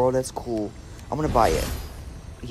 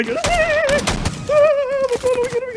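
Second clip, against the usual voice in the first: shrieking high and loud in real panic; panicked scream; 4 dB louder than usual. Real, not acted. Player caught by an enemy, shrieking in panic. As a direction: scream as the enemy appears right there.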